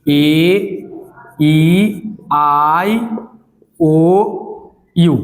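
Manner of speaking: vowels said with the nose pinched closed; nose held shut. Shown as a demonstration. Teacher showing class vowels with nose pinched shut. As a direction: sound each vowel with your nose blocked. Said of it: "हम आराम से नाक बंद करके".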